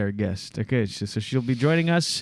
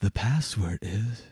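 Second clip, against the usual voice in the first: slow, soft hushed game show whisper; Kristen Wiig character; 4 semitones lower, 6 dB quieter, slower than usual. Retold or imitated. Host imitating a sketch character, hushed. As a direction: lean in and hush the password. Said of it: "when they play Password".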